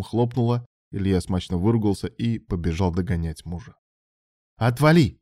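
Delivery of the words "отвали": louder, higher curt snarl; irritated brush-off; snapping back. A narrator's reading. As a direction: bite his head off. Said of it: "огрызнулся Алан".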